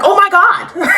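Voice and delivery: high, laughing mock shriek; dramatic gasp. Belly catching Taylor and Steven, laughing mock shriek. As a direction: ham up Belly's shocked gasp through laughter. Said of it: "She's like".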